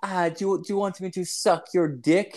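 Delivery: high-pitched mock French girl's offer; French girl come-on. French girl propositions a birthday customer, high mock come-on. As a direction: put on a high, coaxing girl's voice. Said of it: "she's French".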